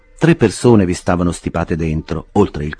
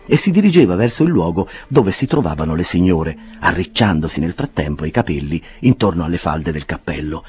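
ordinary delivery

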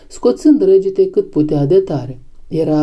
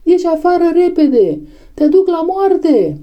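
terrible cry; 12 semitones higher than usual. A narrator's reading. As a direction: urge him out as if his life depends on it. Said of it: "cu o voce teribilă". (strigăt teribil, disperat, pe ton înalt)

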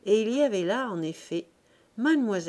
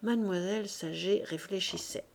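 ordinary delivery, nothing marked

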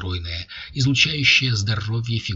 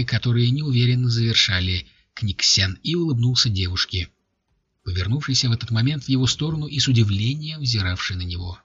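ordinary delivery, nothing marked

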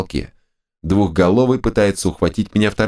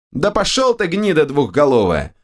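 high angry snap; snapping back; 8 semitones higher than usual. A narrator's reading. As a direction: snarl it back at the ogre. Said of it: "огрызнулся я".

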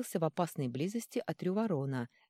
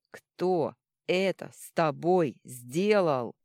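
loud, slow, each word separated; barely held rage, spelling it out; furious, word by word; 7 dB louder, much slower than usual. Acted. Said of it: "наливаясь кровью, раздельно произнёс".

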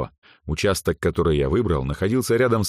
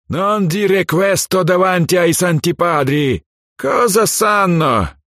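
jolly italian pizza chef; 11 semitones higher, 5 dB louder, slower than usual. Acted. Overheard Italians in the garden.

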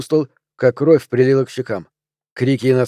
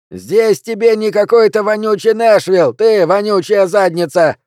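much higher, loud rough jeer; crowd heckler's yell; 8 semitones higher, 5 dB louder than usual. Acted. Heckler yelling abuse at Pelvis on stage, high and loud. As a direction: howl the insult from the crowd. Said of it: "выкрикнули из публики".

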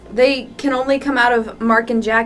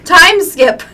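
ordinary talk, nothing marked

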